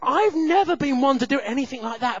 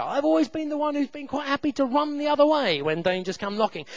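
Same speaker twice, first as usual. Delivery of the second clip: usual speaking voice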